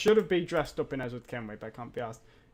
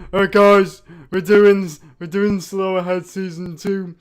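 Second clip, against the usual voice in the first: loud mock announcement; mocking announcer; 2 semitones higher, 10 dB louder than usual. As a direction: parody their big announcement at full volume. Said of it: "and then you announced".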